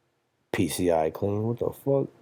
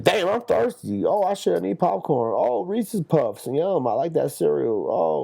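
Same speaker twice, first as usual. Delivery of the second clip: much higher, dreamy voice of a hooked viewer; mock craving consumer; 10 semitones higher than usual. Podcaster mocks a viewer swayed by ads, high dreamy voice.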